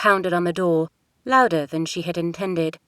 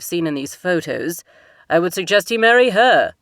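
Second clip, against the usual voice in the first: louder, firm royal father; a king laying down the law to his daughter; stern king; 7 dB louder than usual. Acted. King's voice held by narrator.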